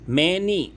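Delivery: MANY (many) drawn out in two beats, high; syllable split. Shown as a demonstration. Teacher showing students two syllables, many drawn out. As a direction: stretch many so both syllables show. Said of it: "दो होते हैं".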